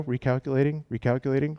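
mock GPS announcement. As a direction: imitate the sat-nav recalculating. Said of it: "Remember how it used to go?".

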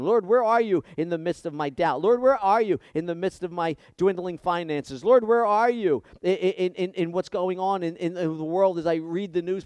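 loud, high cry to the Lord; pleading prayer. Acted. Believer crying out to the Lord in grief, loud and pleading. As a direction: beg God to show himself. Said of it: "but we might say".

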